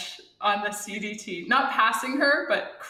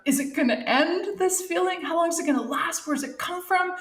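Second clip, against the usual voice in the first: high, rising string of mock-worried questions; anxious questioning; 8 semitones higher than usual. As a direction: voice doubts in a raised pitch. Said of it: "or question of".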